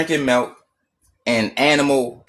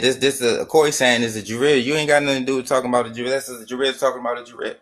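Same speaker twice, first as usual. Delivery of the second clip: ordinary delivery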